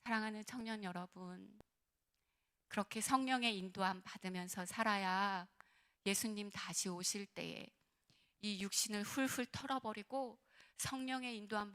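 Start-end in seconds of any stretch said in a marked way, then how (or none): none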